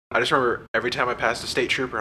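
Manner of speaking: ordinary delivery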